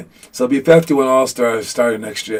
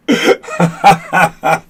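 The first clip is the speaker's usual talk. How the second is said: real laugh; 2 semitones higher than usual. Real, not acted. Laughing at the accusation.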